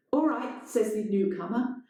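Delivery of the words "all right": high, firm interruption; lightly voiced intruder; newcomer's voice. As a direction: cut him off. Newcomer cuts in.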